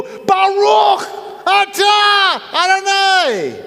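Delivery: high, slow demonstrative shout; yelled prayer. Shown as a demonstration. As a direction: yell each word out, long and high. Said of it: "at the top of their lungs".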